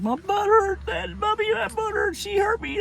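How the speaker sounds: put-on very high-pitched child whine; mock tearful boy voice, squeaky and high; whining little brother. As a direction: complain like a sore little kid.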